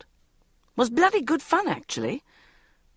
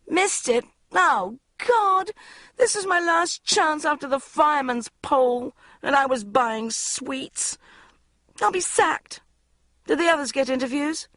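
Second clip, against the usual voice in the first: panicked; 9 semitones higher than usual. A narrator's reading. Panic after missing interview.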